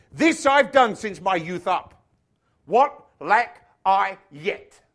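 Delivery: loud, slow, heavily spaced acted quote; declaring. Rich young man answering Jesus about the commandments, declaring. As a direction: declaim it word by word, forcefully.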